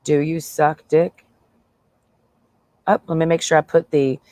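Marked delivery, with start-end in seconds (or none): 0.0-1.2 slowed, drawn-out "Do you suck dick?"; slow mock question. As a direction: stretch it out slowly, mockingly. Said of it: "he just asked me".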